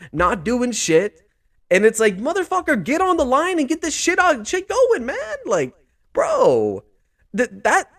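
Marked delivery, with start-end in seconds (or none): 2.2-5.4 high-pitched exasperated outburst at the players; frustrated mock yell. Vlogger imagining yelling at players to get to the line, high exasperated outburst. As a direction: urge the team to move, voice shooting up.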